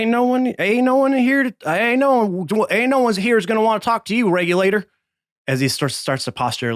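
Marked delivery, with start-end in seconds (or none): 0.0-4.8 much higher, fast stammering bluster; flustered high bartender. Acted. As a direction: bluster to cover his fear. Bartender postures.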